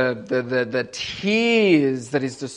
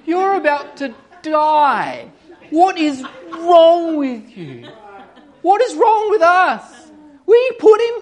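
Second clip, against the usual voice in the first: rebuking; 12 semitones higher, 7 dB louder than usual. Retold or imitated. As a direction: scold the other thief in disbelief. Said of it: "rebuked him".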